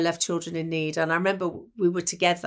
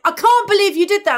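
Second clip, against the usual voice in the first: amazed exclamation; 12 semitones higher, 7 dB louder than usual. Retold or imitated. Terry quoted, sweet swap prank.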